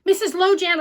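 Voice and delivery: high, loud urgent call; boy shouting for the librarian. Acted. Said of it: "I shouted".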